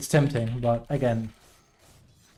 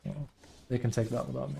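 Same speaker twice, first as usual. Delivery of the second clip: ordinary talk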